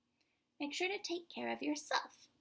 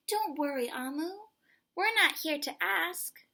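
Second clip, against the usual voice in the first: put-on higher, louder child voice reassuring Amu; little boy's voice; 6 semitones higher, 8 dB louder than usual. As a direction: play the eager little boy, bright and up. Boy reassures Amu in story.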